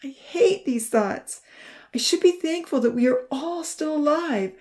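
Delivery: high, loud, anguished self-reproach; upset and pleading, pitched high; tearful distress. A narrator's reading. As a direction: berate yourself on the edge of tears. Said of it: "he broke down crying again".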